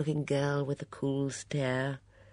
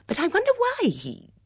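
very high, loud, wondering; curious voice leaping up, then breaking off; Bunch; 7 dB louder than usual. A narrator's reading. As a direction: burst out puzzled, pitched high.

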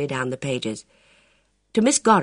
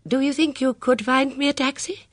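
higher, doubtful lady asking; a lady unsure of herself; 11 semitones higher than usual. A narrator's reading. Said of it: "said the lady doubtfully".

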